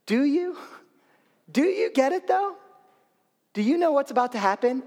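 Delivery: high, doubting sing-out questions; ironic Jesus. Acted. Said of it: "Jesus speaking somewhat ironically".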